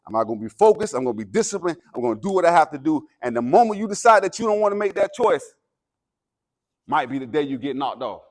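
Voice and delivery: rising, loud motivational preaching; halftime pep speech. Acted. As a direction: fire up the team before the game. Escalating pep speech to team.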